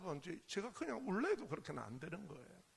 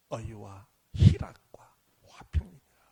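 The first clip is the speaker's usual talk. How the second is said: reverent scripture whisper; 9 semitones lower, 14 dB louder, slower than usual. Acted. Preacher quoting scripture with reverence.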